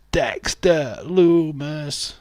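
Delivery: high, loud, stretched-out name; dramatic name reveal. Real, not acted. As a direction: draw the name out slowly and project it.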